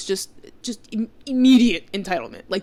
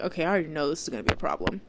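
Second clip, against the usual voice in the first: quick inner thought; 3 semitones lower, 5 dB quieter, much faster than usual. Retold or imitated.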